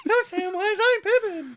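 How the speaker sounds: mock Pippin voice, loud and giggly; Pippin impression. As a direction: squeal it through a laugh. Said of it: "So now you've gotta do pepper".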